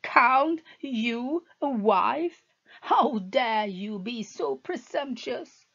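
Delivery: husband berating his wife; contemptuous anger. Acted. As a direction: spit out the scorn. Husband rages.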